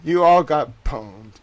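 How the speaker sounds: loud, slow gloating jeer; gloating taunt. Real, not acted. Gloating at rivals.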